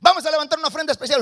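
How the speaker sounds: quick, high-pitched mock pastor's announcement; pastor pidiendo ofrenda. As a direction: rattle off the offering call like a pastor. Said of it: "Aquí el pastor dice". (imitando a un pastor, anuncio rápido de la ofrenda)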